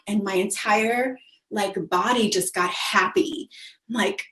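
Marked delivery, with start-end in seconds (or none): none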